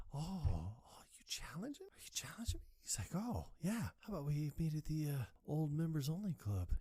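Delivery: high, hushed mocking challenge; high whispered taunt. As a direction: taunt him in a thin whisper. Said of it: "It's like".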